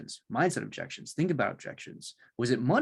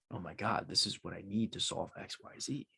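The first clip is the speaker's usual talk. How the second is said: hushed realization; 3 semitones lower, 9 dB quieter than usual. Pretend. Client's imagined relief.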